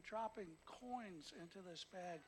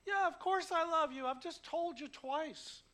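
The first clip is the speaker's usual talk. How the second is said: hurt Peter; 8 semitones higher, 11 dB louder than usual. Retold or imitated. Preacher voicing a hurt Peter answering Jesus, loud and high. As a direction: let the wounded feelings burst out. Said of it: "gets hurt. His feelings get hurt".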